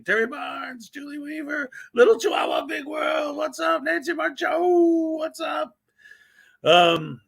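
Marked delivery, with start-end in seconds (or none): 0.0-5.8 names called out high and bright in a new voice; sing-song the names up high as a new bit; high-pitched roll call of names; new high-pitched shout-out voice. Shown as a demonstration. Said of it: "Yeah, that's my new thing".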